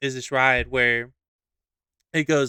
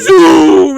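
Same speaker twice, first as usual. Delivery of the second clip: long, loud, high "zoom" sliding down; drawn-out falling sound effect; whooshing ride noise; 12 semitones higher, 14 dB louder than usual. Retold or imitated. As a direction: stretch it out like a sudden plunge. Imitates the ride dropping.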